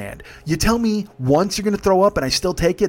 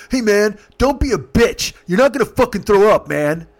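raised, louder voice, mock-angry friend; acted scolding; 6 semitones higher, 5 dB louder than usual. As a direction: tell off your drunk buddy. Friend scolds his queasy drunk buddy.

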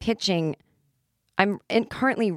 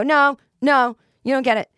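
1 loud protest; 7 semitones higher, 8 dB louder than usual. Retold or imitated. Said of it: "I go".

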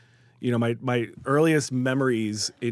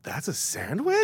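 put-on amazed kid; 8 semitones higher, slower than usual. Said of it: "and I was like".

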